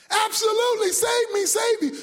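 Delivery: high, urgent pleading; screamed plea. Acted. Man on the branch begging God, high urgent cry. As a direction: cry out frantically for rescue. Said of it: "and he screamed".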